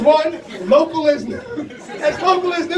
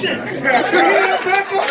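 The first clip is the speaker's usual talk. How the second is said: calling out; 5 semitones higher, slower than usual. Real, not acted. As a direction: call out to her across the room. Calls out to wife in crowd.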